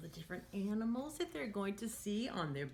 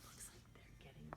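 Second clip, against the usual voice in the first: hushed bedtime whisper; soft whisper to children at bedtime; 3 semitones lower, 20 dB quieter than usual. Acted. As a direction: whisper so the animals can sleep. Bedtime ending of story.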